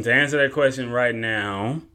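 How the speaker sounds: ordinary talk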